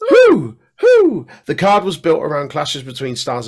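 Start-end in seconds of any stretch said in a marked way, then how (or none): 0.1-1.3 very high, loud hooting. Acted. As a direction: hoot like an owl. Owl-like hooting.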